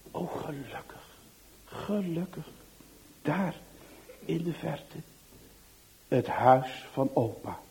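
soft, hushed sigh of relief; whispered relief. A narrator's reading. Narrator whispering relief as grandpa's house appears, hushed. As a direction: breathe it out, safe at last.

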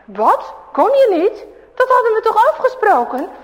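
high, loud indignant bully; outraged that they back out; a hammed-up angry kid; bully boy indignant. A narrator's reading. Bully Huip protests angrily.

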